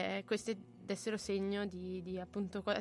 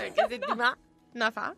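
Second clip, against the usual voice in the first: laughing; 6 semitones higher, 6 dB louder than usual. Real, not acted. Teases colleague's stumble.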